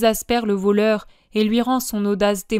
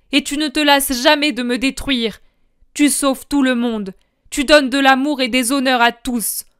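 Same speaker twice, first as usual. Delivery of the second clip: higher, louder bitter rant; raging retort; 3 semitones higher, 5 dB louder than usual. Acted. Judas retorting to Jesus, bitter raging rant. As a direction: throw every grievance back at him.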